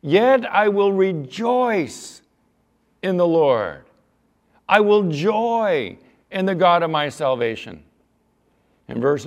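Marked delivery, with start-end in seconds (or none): 0.0-7.8 slow, loud, soaring proclamation; fervent preaching. Acted. Preacher proclaiming Habakkuk's vow of joy. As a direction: declare it like a triumphant vow. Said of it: "Let me read that again".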